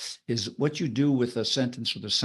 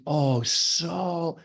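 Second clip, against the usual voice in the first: "so" held long and a little higher; dragged-out SO; 4 semitones higher than usual. Shown as a demonstration. Teacher demonstrating duration, SO dragged out. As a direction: stretch "oh, so" right out. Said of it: "they drag it out".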